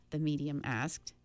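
ordinary delivery

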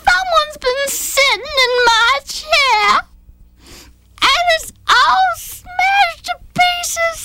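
very high, slow, tearful little bear; crying Baby Bear. A narrator's reading. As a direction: sob it out like a tiny heartbroken bear. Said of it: "crying over a pile".

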